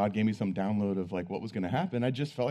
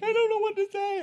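overwhelmed woman; 4 dB louder than usual. Retold or imitated. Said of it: "she's just like".

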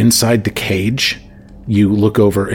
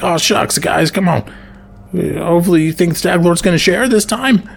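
goofy high voice; 11 semitones higher than usual. Acted. Ox cheers the booze arriving, high goofy voice. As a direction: pitch it up high and eager. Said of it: "is just like".

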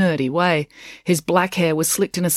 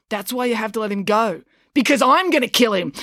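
fierce threat; 6 semitones higher than usual. A narrator's reading. April takes over the fight.

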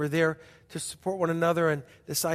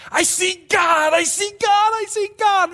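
louder and higher, slowly rejoicing; ecstatic shouting; 9 dB louder than usual. Retold or imitated. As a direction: shout it out like a sudden revelation. Woman jumping up and down, ecstatic.

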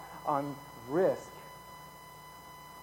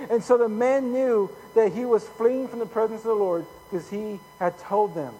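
raised, projected Bible storytelling; dramatic scripture reading; 8 semitones higher, 8 dB louder than usual. A narrator's reading. Preacher reading Jonah's storm story to the congregation, raised dramatic reading. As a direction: read the story out loud with force.

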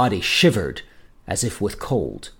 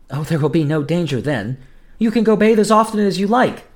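high-pitched, lightly voiced girl reassuring; eager reassurance; 7 semitones higher, 4 dB louder than usual. A narrator's reading. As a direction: climb higher and louder, insisting all is safe. Julia eagerly reassures her betrothed.

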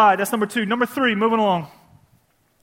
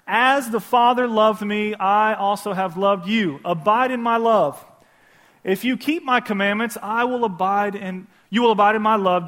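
scripture reading; 3 semitones higher, 4 dB louder than usual. Acted. Reads scripture aloud.

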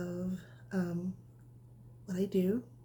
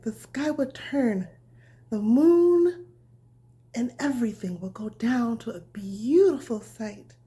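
bedtime story reading; 2 semitones higher, 8 dB louder than usual. A narrator's reading. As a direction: read it gently like tucking a child in. Reads bedtime story to children.

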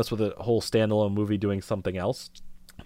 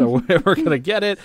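voice cracking up an octave; 8 semitones higher, 5 dB louder than usual. Real, not acted. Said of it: "my voice cracked right there".

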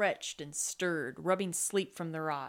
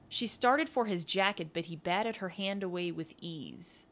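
ordinary delivery

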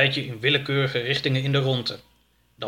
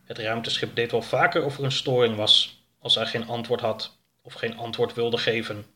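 plain reading voice

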